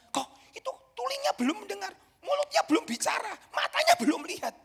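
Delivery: tense and rising with worry; alarmed questioning, pitched high; panicked complaint. Retold or imitated. Panicked pastor asks friend.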